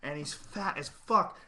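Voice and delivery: ordinary talk, nothing marked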